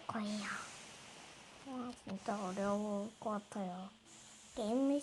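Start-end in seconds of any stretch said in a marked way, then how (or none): 0.1-3.9 whisper. Real, not acted. Whispered aside while gaming.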